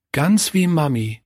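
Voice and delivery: put-on high child's voice, fondly marvelling; admiring child. A narrator's reading. Kurt compares the carved figure to his mother, admiring.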